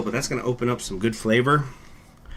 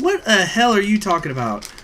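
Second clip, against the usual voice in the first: normal talking voice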